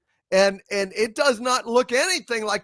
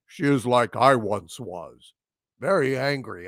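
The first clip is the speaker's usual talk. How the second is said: much lower, solemn mocking Worf; deadpan a gloomy Worf; 10 semitones lower than usual. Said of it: "have Worf say".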